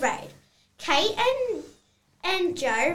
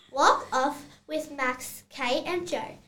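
ordinary talk

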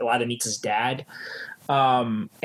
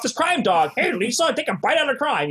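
gruff cartoon dog slogan voice; mock crime dog ad voice; imitating McGruff; 11 semitones higher than usual.

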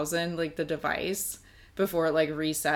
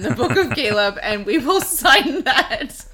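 laughing; 6 semitones higher, 11 dB louder than usual. Real, not acted. Joking, laughing loudly.

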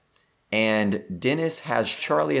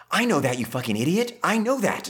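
whisper yelling; 6 semitones higher than usual. Retold or imitated. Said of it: "whisper yell where she's like".

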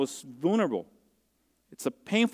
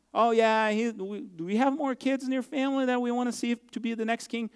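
higher, mocking asker with an odd voice; mock questioner; 8 semitones higher than usual. Prophet to David's family, higher, mock-casual question. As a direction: play the visitor asking about more sons.

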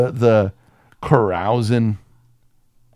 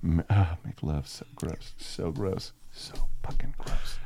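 trailing off softer into a whisper; disgusted; 6 semitones lower, 10 dB quieter than usual. Real, not acted. Friend recoils at the innuendo, fading to a whisper. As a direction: groan it away in disgust.

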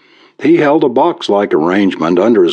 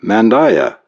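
pitched up, mildly impatient. A narrator's reading. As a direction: press the demand with a touch of impatience. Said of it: "the stranger said impatiently".

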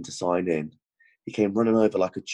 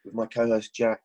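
higher, announcer-style hello to the city; upbeat show opener; 6 semitones higher than usual. Acted. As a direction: open the show like a radio host greeting Denver. Podcast opening greeting to city.

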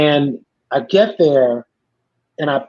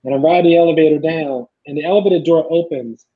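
ordinary talk

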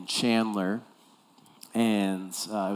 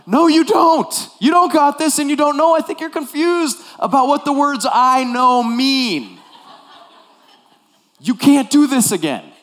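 exasperated coach yell; 12 dB louder than usual. Retold or imitated. Coach losing patience with his player, exasperated yelling. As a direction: let the patience snap, yell it. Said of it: "my patience is gone".

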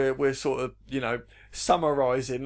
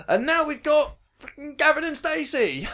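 loud, high mock announcer; 13 semitones higher, 6 dB louder than usual. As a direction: belt out a cheery link, mockingly. Mimics telethon switching to sitcom.

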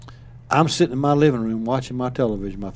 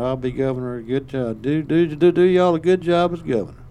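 a tipsy governor staring down the camera, over-solemn; drunk Big Jim; 5 semitones higher, 8 dB louder than usual. Retold or imitated. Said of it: "he starts mumbling these words".

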